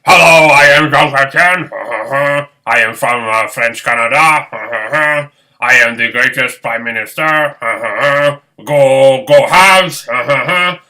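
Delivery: loud mock French-Canadian accent with cackling laughs; Jean Chrétien impression. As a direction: ham up a French-Canadian prime minister, loud. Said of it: "pretend I was Jean Chrétien".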